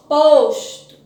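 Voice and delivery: Posto called out loud, punching the first syllable; strong syllable aloud. Shown as a demonstration. Teacher showing pupils the strong syllable, Posto with loud first syllable. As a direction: hit the Pos in Posto loudly. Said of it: "vamos pronunciar em voz alta e descobrir".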